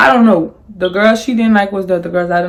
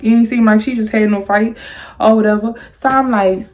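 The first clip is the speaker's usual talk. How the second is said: normal talking voice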